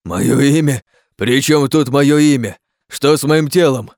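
loud, high, incredulous questions; indignant shouting. Acted. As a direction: throw the questions back angrily. Furious quarrel over changed body.

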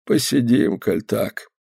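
put-on subdued old man's voice; resigned old man. A narrator's reading. Old man settling on the grass, resigned. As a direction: trail off softly in resignation.

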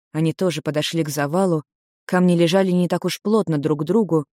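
ordinary delivery, nothing marked

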